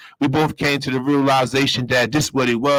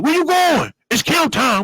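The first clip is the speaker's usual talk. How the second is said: guard yelling. Retold or imitated. Police at the gates yelling during count. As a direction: halt him at the gate. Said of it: "they yelling".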